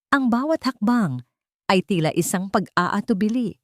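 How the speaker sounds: ordinary talk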